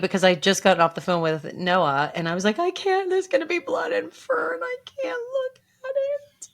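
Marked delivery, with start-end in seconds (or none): 2.6-6.5 very high, tearful squeal; crying. Retold or imitated. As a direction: re-enact sobbing into the phone, squeaky high. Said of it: "in the car crying".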